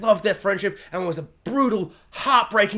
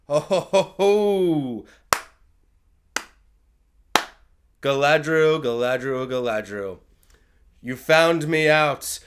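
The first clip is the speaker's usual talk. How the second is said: villain reveal; 6 semitones lower, slower than usual. Retold or imitated. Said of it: "really bizarre moment where he goes".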